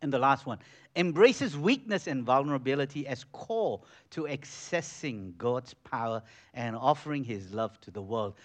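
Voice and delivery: ordinary talk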